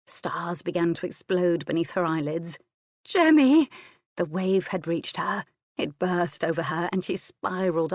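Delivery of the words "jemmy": loud, high cry of his name; cried name. A narrator's reading. Woman at her climax crying her lover's name. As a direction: shout his name at the peak.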